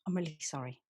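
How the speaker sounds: a quiet, sheepish apology. Acted. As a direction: drop to a hushed, rueful I'm really sorry. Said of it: "I must apologise for her".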